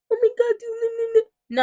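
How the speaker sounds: high, loud, frantic fluster; mock panic. Mother parodying a panicky parent, high and loud mock alarm. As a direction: squeal like a parent losing it.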